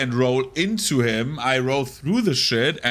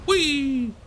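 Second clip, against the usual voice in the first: excited squeal; 14 semitones higher than usual. Real, not acted. Squeals while playing.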